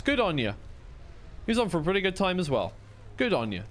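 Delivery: normal talking voice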